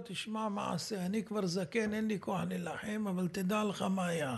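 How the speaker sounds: put-on tired old rabbi. Host retelling the old rabbi's words, soft and weary. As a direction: let it sink low and tired, like an old man worn out.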